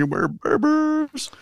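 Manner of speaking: high, slow playful sing-song. Real, not acted. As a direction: toss it off in a silly high lilt. Cohosts joking about birthdays.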